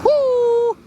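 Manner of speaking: high excited whoop from the cold; cold water whoop. Real, not acted. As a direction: yelp at the icy water.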